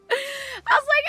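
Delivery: high laugh before the words. Real, not acted. Laughing while retelling mom call.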